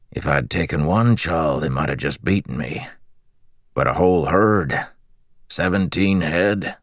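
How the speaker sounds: lightly raspy, boasting with pride; a hint of rasp, savoring the boast; proud raspy thief. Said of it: "He chuckled to himself, admiring his own audacity".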